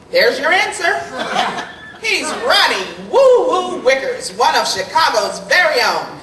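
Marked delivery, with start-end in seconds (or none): none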